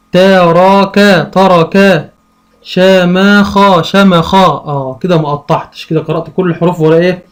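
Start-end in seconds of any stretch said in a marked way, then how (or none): 0.1-1.2 تراكا stretched out slowly; slow, split reading. Shown as a demonstration. Teacher sounding out for a child, slowly. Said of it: "عندي مثلًا أقول". 2.6-3.8 شماخا stretched out slowly; slow, split reading. Shown as a demonstration. Teacher sounding out for a child, slowly. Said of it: "عندي مثلًا أقول".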